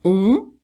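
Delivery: "Um" as a nasal vowel between o and u; nasal French on. Shown as a demonstration. Teacher demonstrating the nasal sound, between O and U. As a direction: say "Um" as French on, no closed m. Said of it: "Entre o O e o U".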